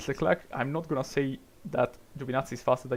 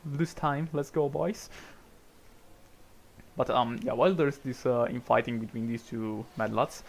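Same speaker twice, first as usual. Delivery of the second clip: ordinary talk, nothing marked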